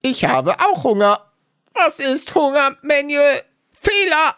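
high, stiff robot voice; robotic error message. Pretend. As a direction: speak like a malfunctioning AI. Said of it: "Ich bin seit vielen Folgen eine K I". (hohe, steife Roboterstimme)

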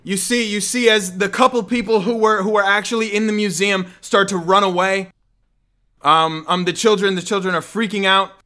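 loud, rising storyteller intensity; dramatic narration. Acted. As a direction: build the chaos as you narrate. GM narrates the chaos.

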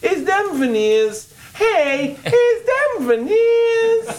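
high, slow, rising Brooklyn voice; exaggerated Brooklyn accent. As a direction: stretch it out in a Brooklyn squeal. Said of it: "That's the voice".

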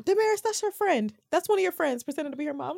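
high-pitched mock mom, rattled off; accusing mom. Mom catching her daughter's lie, sharp accusation. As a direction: call out the kid's fib.

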